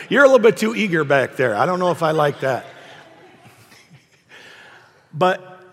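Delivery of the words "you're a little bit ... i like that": loud, quick words through a laugh; laughing tease. Real, not acted. Preacher to an over-eager congregation, laughing tease. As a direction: tease them while still laughing.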